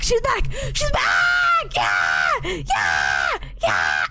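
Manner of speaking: high squeal breaking into raw screamed cheers; ecstatic screaming. Real, not acted. Streamer seeing Big Ham return, high excited screaming. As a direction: shriek the news, then scream in celebration.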